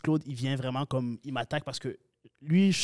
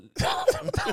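short high-pitched laugh; quick high chuckle. Real, not acted. Amused by what happened afterwards.

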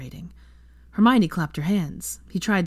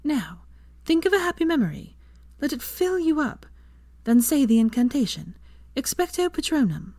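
higher woman's voice teaching the spell; instructing; 9 semitones higher than usual. A narrator's reading. Hermione teaching Draco the Patronus charm, instructing. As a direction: coach him through the spell, higher. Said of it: "Hermione instructed".

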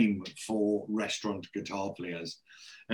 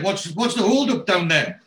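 a higher, louder call across the room; 8 semitones higher, 5 dB louder than usual. Retold or imitated. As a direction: shout the question over to them. Dennis calls across the room.

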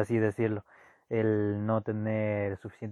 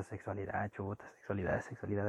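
muttered under the breath, much softer; nervous classroom murmur, said much softer; hushed murmur; 2 semitones lower, 11 dB quieter, faster than usual. Retold or imitated. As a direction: murmur it to the kid beside you. Classmates murmuring about the talk.

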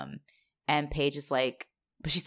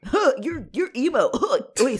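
mocking dumb guys; 10 semitones higher, 5 dB louder than usual. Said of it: "they're just like".

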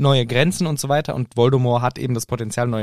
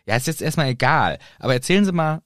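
ordinary delivery

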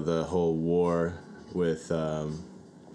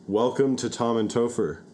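loud, higher, forceful welcome; aggressive; 6 semitones higher, 4 dB louder than usual. Acted. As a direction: come in swinging with the welcome. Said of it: "why I'm being so aggressive".

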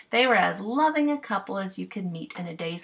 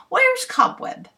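higher, slow, self-important clown; pompous clown call; 9 dB louder, slower than usual. Acted. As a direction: call out like a proud bumpkin. Clown calls fairy.

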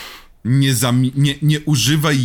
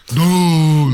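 loud, long drawn-out call; mock yell; 4 semitones higher, 6 dB louder than usual. Shown as a demonstration. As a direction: bellow the short name, held high. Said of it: "nie jest dobra rzecz do wrzeszczenia".

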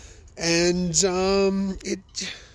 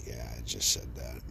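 much lower and much softer, almost under the breath; very quietly; 13 semitones lower, 11 dB quieter than usual. Retold or imitated. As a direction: drop the voice right down as if letting it slip. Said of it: "I said it very quietly".

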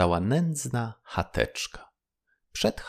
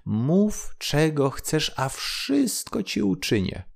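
high, slow, merry fish voice; cheerful call; 7 semitones higher than usual. Acted. Grateful golden fish calls cheerfully to the fisherman. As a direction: sing it out gladly. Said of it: "zawołała wesoło".

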